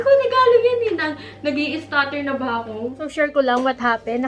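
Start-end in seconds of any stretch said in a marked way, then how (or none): 0.1-0.9 high, loud insistence; excited exclamation. Retold or imitated. Wife exclaiming to her husband that the bag held gifts. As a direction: burst out that it's a gift.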